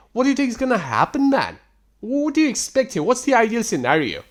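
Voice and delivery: high, incredulous questioning; exasperated. Real, not acted. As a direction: challenge him with pitch leaping up. Exasperated rant at the texter.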